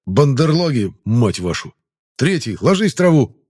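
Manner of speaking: loud curse, then a high yelled command; shouting. Acted. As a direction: yell across the field under fire.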